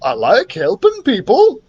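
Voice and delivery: pleased, lilting character voice; happy. A narrator's reading. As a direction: say it with eager pleasure. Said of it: "he said happily".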